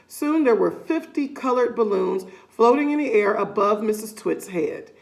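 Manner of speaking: plain reading voice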